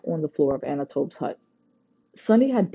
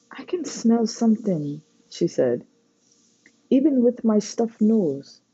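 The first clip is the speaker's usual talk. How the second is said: put-on girl's voice, higher, wondering; Sunny's voice; 7 semitones higher than usual. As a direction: sound amazed at a new sense of smell. Sunny describes new sensation.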